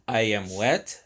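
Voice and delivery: normal talking voice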